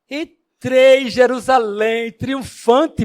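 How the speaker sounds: loud, slow, proud proclamation; little donkey boasting to mother, proud and loud; triumphant boast. Acted. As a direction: trumpet your own triumph.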